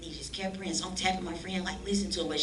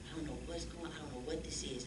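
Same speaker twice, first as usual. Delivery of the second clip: hushed bewilderment; 13 dB quieter than usual. Retold or imitated. Bewildered in pastor's arms.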